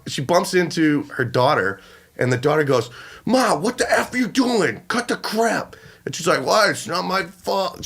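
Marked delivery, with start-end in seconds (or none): 3.3-5.7 higher-pitched mock scolding of Ma; irritated daughter. Daughter snapping at her mother in story. 6.5-7.8 drawn-out, higher impression of the mother; whining mother. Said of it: "the mother actually did sound like this".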